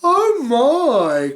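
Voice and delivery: high, loud fretful cry; worried cry. Acted. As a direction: gasp it in alarm. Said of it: "cried Mister Worry".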